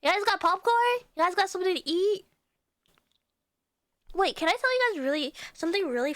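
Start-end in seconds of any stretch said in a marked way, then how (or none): 0.0-2.2 cute anime streamer. Acted. Cute question to chat.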